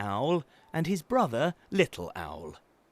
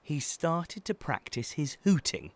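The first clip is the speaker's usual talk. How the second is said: ordinary delivery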